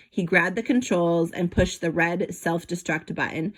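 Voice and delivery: plain reading voice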